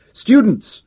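high, loud hail; calling out. A narrator's reading. Professor addressing students, calling out. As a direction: summon the whole crowd's attention. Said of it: "he called out".

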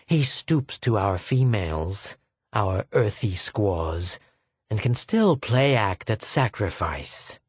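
ordinary delivery, nothing marked

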